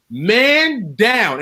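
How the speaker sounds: high mock yell, drawn out; imitated scream. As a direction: holler it like a retold alarm. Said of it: "she screams out".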